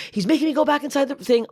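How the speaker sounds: high, exasperated re-enactment; angry. Retold or imitated. Shopper narrating to her phone, high angry complaint. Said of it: "I was so angry".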